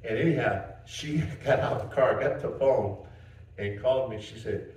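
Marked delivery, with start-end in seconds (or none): none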